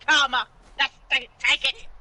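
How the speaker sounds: loud triumphant jeer; loudly gloating over his bad luck. Real, not acted. Gloating at partner.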